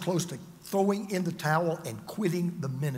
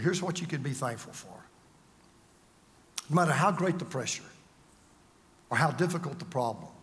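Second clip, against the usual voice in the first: ordinary delivery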